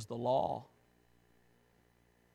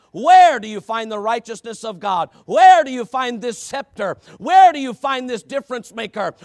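loud, rising preacher's call; fiery pulpit preaching; preaching at full force; 7 semitones higher, 13 dB louder than usual. Acted. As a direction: build the questions to a shout.

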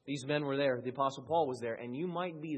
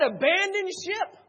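high, loud cry to abandon ship; a loud high mock alarm; 9 dB louder than usual. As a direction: shout it like a panicked sailor. Preacher voicing quitters' cry.

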